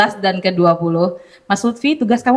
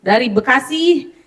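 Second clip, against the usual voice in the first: booming prize announcer; 5 semitones higher, slower than usual. Real, not acted. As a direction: announce the winners with energy. Hosting a live prize draw.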